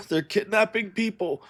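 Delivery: high, weak voice of a dying man; gasping out what he saw; wounded dying man. Acted.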